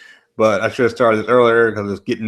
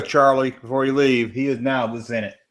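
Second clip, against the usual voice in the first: ordinary talk, nothing marked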